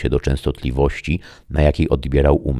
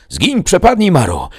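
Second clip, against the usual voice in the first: much higher, loud frightened cry warding off a ghost; shouted exorcism; 5 dB louder than usual. A narrator's reading. Winiarski hurling a curse at the phantom, high shouted banishing. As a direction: banish the spirit at the top of your voice. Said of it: "krzyknął Winiarski".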